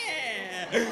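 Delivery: loud, cocky cheer; triumphant yeah. Retold or imitated. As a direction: celebrate like a winner. Walking away triumphant.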